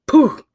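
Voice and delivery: sudden little poof; poof sound effect. Retold or imitated. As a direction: pop the word out like an impact. Frog hit on dark road.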